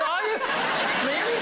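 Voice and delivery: high teasing disbelief; mock surprise. Real, not acted. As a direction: play up the amazement. Host teases contestant's origin.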